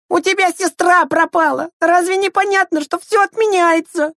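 put-on squeaky-high scolding child; very high, impatient kid voice; losing patience. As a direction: lecture him in exasperation. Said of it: "потерял терпение Андрейка".

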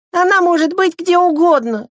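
put-on high-pitched kid's lament; sighing young boy. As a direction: wail it like a helpless little brother. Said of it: "вздохнул Андрейка".

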